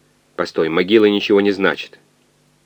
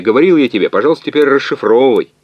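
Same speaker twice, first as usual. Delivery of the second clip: irritated, raised higher and louder; сердито; 6 semitones higher, 6 dB louder than usual. A narrator's reading. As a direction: snap at a colleague in mild exasperation. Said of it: "Кибернетик сердито посмотрел на физика". (с сердитым упрёком)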